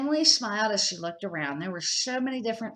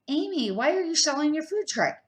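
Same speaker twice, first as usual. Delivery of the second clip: put-on higher child's voice, puzzled; curious child; 8 semitones higher, 5 dB louder than usual. A narrator's reading. Ethan asks Amy why she is selling, higher childlike voice. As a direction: ask like a puzzled boy.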